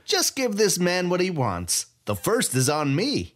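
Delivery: put-on gloating thief greeting an old rival; gleeful Sai. A narrator's reading. Sai voiced by narrator.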